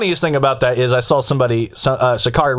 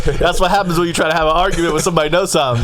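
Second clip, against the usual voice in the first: mock triumphant; 6 semitones higher than usual. Mock-boasting after retold argument.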